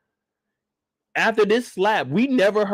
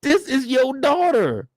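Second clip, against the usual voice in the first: outraged reaction; 6 semitones higher than usual. Retold or imitated. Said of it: "I'm like".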